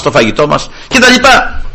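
ordinary talk

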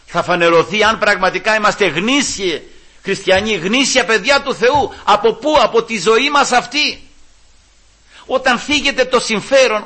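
loud, high, forceful sermon voice; impassioned preaching. Real, not acted. Preacher challenging believers to prove genuine faith, impassioned. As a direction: proclaim it at full force.

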